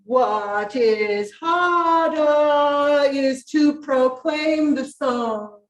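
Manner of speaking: slow, drawn-out "What is harder is to proclaim the song"; imitating the choir. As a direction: mimic the choir's slow phrasing. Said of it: "here's what I heard now".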